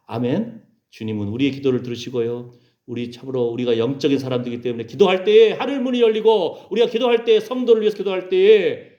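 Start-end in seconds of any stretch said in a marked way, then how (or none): 4.9-8.9 raised, ringing pulpit voice; forceful, rhythmic exhortation; fervent preaching. Real, not acted. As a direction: build each phrase to a cry.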